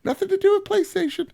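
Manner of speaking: very high put-on papa voice; hammed-up falsetto explaining himself; Andrew House. Fake father tells kids where he works, silly high impersonation. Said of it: "And I'm like".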